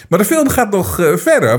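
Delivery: normal talking voice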